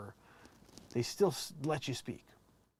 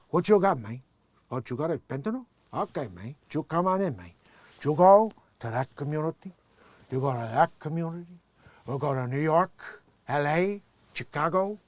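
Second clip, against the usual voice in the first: louder, put-on Cuban gangster accent; Scarface accent; 7 dB louder than usual. Impersonating Scarface in a Cuban accent, swaggering. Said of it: "Scarface reference".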